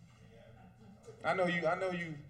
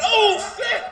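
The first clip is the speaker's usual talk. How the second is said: high, loud sudden yelp; mock excited yelp; 12 semitones higher, 12 dB louder than usual. Man imagining snatching a wig mid-act, sudden yelp. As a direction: blurt it out like a startled man. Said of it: "if I get excited".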